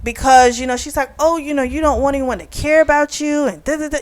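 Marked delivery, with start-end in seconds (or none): 1.2-4.0 imitating a worked-up woman, higher and hurried; mock worked-up complaint. Recapping how Kamani frets to the women, mock worked-up. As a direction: rattle it off high, mocking her fuss. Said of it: "Kamani's getting all worked up".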